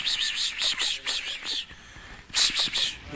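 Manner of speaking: mimicked "pss pss" of a hushed courtroom talk; imitated whispering. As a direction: hiss out the whispering sounds. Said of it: "was going like that".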